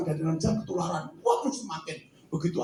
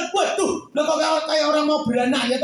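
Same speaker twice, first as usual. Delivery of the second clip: ordinary delivery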